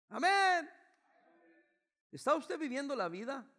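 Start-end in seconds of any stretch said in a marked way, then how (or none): 0.1-0.7 loud amen. Real, not acted.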